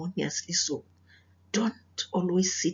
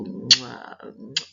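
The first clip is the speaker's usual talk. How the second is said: blown kisses; 7 semitones lower, 3 dB louder than usual. Real, not acted. Blowing kisses to the girls.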